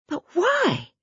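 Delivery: high, urgent cry; cried out. A narrator's reading. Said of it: "cried Lou".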